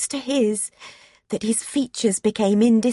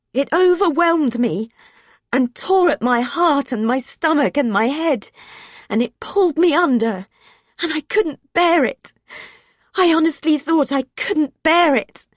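grief-stricken narration; 5 semitones higher, 3 dB louder than usual. A narrator's reading.

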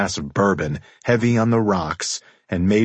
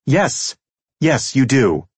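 high, loud woman's interjection; emphatic, higher feminine agreement; cutting in; 6 semitones higher, 6 dB louder than usual. A narrator's reading. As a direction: cut across him sharply, pitched up. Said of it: "she cut through my words".

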